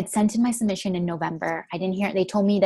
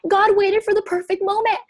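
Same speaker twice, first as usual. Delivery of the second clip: much higher, overwhelmed and near tears; tearful excitement; 12 semitones higher, 5 dB louder than usual. Retold or imitated. Retelling her walk in New York after acceptance, tearful high excitement. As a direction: let the joy crack the voice upward. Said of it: "And I'm, like, crying".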